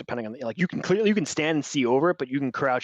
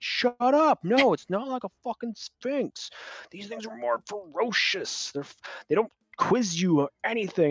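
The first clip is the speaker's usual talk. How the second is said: irritated know-it-all; 9 semitones higher than usual. Retold or imitated. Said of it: "and he's like".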